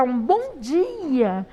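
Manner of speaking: cheerful, sing-song good morning with a big pitch swoop; happy greeting. Shown as a demonstration. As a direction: greet them happily, stretching it out. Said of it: "Se você tá feliz". (bom dia feliz e cantado)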